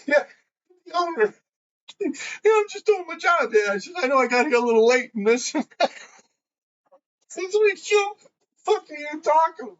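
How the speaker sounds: high laughing voice breaking up; laughing through quotes. Real, not acted. Retelling fooling the shop owner, laughing high. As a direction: laugh your way through every word.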